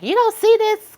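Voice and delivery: squeaky high disbelief; incredulous. Retold or imitated. Scolding a person who misses the obvious, squeaky disbelief. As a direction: shoot way up in pitch, stunned.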